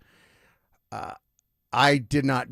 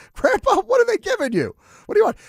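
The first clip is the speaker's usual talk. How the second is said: high, loud disbelief; baffled; 7 dB louder than usual. Retold or imitated. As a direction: react as if grandpa lost his mind. Grandson baffled by hallucinations.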